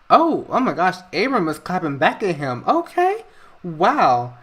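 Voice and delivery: thrilled viewer egging on the fight; excited gasp. Real, not acted. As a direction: gasp at the comeback like it's gossip. Reacting to argument on screen.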